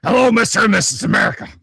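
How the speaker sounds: loud, fast, high ranting radio host; booming fast talk-radio rant; Alex Jones impression. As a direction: bark it like a conspiracy radio host. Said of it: "Alex Jones with the rest of the story".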